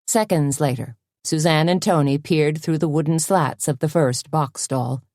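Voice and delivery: ordinary delivery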